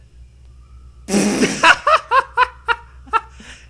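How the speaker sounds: high loud burst of laughter; howling laugh. Real, not acted. Reacts to quiz level shock.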